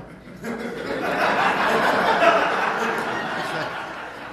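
a long, breathy burst of laughter; big laugh. Real, not acted. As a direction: laugh out loud at the memory. Laughing at his own story.

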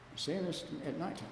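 usual speaking voice

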